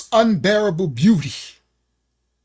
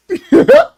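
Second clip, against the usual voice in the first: a sudden high, loud laugh; high burst of laughter; 14 semitones higher, 5 dB louder than usual. Real, not acted. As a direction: laugh out at his own joke. Laughing at his own joke.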